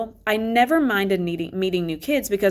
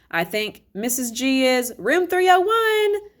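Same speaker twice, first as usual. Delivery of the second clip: calling from another room; 7 semitones higher, 3 dB louder, slower than usual. A narrator's reading. Mrs Garcia calls from other room.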